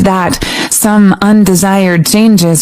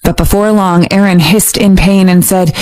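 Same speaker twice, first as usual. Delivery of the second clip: ordinary delivery, nothing marked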